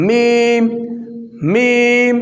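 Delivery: মিম closed on the dry part of the lips; Arabic letter mim. Shown as a demonstration. Teacher showing learners how lips meet. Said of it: "শুকনো অংশগুলো".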